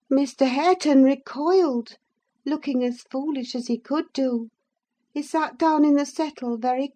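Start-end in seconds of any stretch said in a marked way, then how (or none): none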